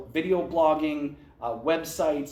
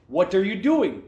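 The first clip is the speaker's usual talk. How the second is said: loud, higher-pitched Eastern European boss; Eastern European accent; 4 semitones higher, 6 dB louder than usual. Retold or imitated. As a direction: put on an Eastern European accent to demand an answer. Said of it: "That's my best Eastern European accent".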